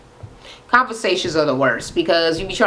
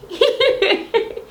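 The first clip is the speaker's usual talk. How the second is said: laugh; 14 semitones higher than usual. Real, not acted. Giggling after gossip.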